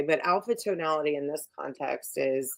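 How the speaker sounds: ordinary delivery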